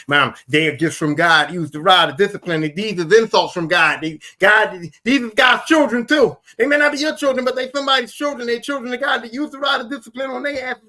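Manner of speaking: loud, high exhortation, building in pitch; impassioned retold plea. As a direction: urge her on, climbing toward a shout.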